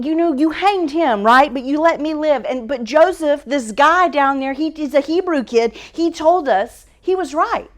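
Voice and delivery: high, excited servant blurting out news; a breathless cupbearer rushing to tell the king; eager cupbearer. Acted. Cupbearer voice continues.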